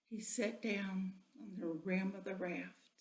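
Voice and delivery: usual speaking voice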